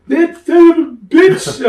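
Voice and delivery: high, loud, slow furious cry; retold furious curse; angry outburst. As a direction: bellow it like an outraged boss. Bruno's angry reaction, retold.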